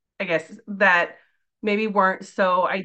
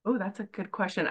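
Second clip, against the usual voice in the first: ordinary delivery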